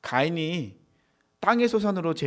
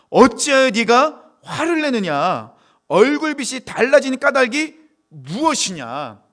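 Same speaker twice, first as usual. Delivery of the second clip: high, loud, slow declaimed question; stretched-out, ringing preacher's reading; scripture proclamation; 9 semitones higher, 10 dB louder, slower than usual. Acted. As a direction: proclaim it as God challenging Cain. Reads God's question to Cain.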